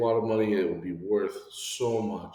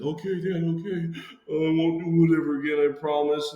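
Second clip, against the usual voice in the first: put-on meek kid promising; 6 semitones higher than usual. Child promising dad meekly.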